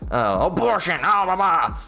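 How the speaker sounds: mock bickering. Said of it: "and they argue".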